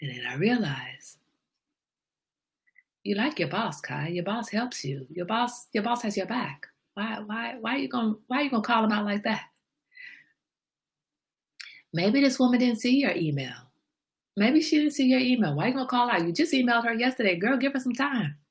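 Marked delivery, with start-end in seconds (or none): 3.0-9.4 soft, higher-pitched self-talk; hushed, coaxing inner voice; talking herself down. Retold or imitated. Talking herself down in her mind. 11.9-18.4 higher-pitched pleading with yourself; high, reasoning self-talk; coaxing inner voice. Retold or imitated.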